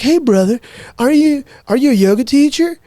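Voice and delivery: high, friendly surfer-dude question; teacher quizzing the student after class, surfer-dude voice; California surfer dude. Retold or imitated. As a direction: ask it like a curious beach bro. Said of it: "total California surfer dude".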